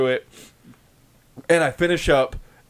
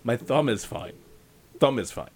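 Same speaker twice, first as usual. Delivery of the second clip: dismissive; 7 semitones lower, 7 dB quieter than usual. Retold or imitated. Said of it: "I'm like".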